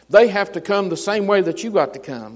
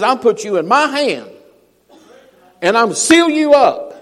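God's promise; 4 semitones higher, 4 dB louder than usual. Acted. Preacher voicing God's promise.

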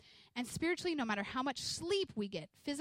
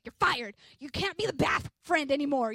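loud mock scolding of her kid, voice up high; mock firing; 4 semitones higher, 10 dB louder than usual. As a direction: playfully fire him, loud and bossy. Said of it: "and I was like".

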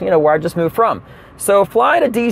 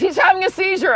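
alarmed stewardess; 12 semitones higher than usual. Retold or imitated. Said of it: "The lady says".

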